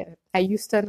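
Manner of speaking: ordinary delivery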